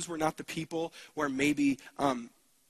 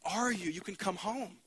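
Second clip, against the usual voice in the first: higher, gentle beckoning voice that fades soft; soft pleading invitation, higher and drifting quieter; tender calling; 4 semitones higher, 3 dB quieter, slower than usual. Acted. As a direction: call them home gently, like a longing father. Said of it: "out of the beckoning of God's heart".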